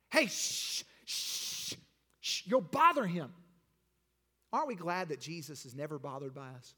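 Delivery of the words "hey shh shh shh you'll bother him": hissed shushes, then a scolding warning; rebuking hush. Acted. Crowd silencing the blind man, rebuking hush. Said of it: "they which went before rebuked him".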